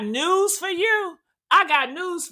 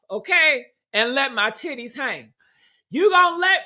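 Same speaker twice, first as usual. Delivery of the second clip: normal talking voice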